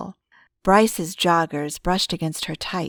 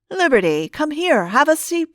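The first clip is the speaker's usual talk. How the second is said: louder and much higher, eager invitation; beaming welcome; 9 semitones higher, 6 dB louder than usual. Acted. Pastor eagerly invites Liberty to sit, beaming welcome. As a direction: wave her in with delight.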